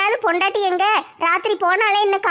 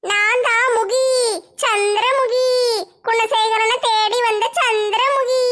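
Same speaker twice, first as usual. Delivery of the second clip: wailing ghost; slower than usual. Acted. Declares herself Chandramukhi, crying.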